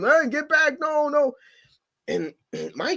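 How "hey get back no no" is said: high-pitched mock warning call; imitating an alarmed bystander; imitated warning. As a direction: call out to warn someone off. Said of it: "somebody trying to tell him".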